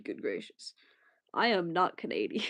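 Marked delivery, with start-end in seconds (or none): 0.0-0.7 much lower, softer whispered aside; hushed joking whisper. Pretend. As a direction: breathe it out as a secret. Hushed joke after stroke bit.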